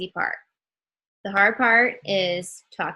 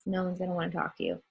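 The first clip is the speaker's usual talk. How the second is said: flat, bored monotone delivery; 8 dB quieter, much faster than usual. Shown as a demonstration. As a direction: drone it out with no life. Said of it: "with a monotone voice like this".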